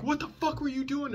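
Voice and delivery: high mock angry cop; a hint of a cop cursing; imitating a cussing cop. Cop cursing at the suspect, lightly voiced high mock. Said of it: "maybe they'd be cussing".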